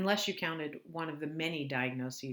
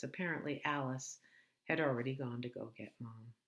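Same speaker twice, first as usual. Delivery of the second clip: usual speaking voice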